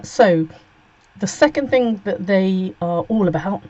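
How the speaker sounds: ordinary talk, nothing marked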